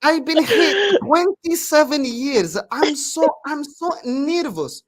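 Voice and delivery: high, slow mock mum voice; imitating an anxious foreign mum; mum's broken English. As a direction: play the fretful mum, drawn out and high. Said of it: "with her broken English".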